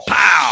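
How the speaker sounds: very loud pow like a hit; loud cartoon pow; mock impact shout. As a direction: bark the impact sound. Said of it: "imitated impact".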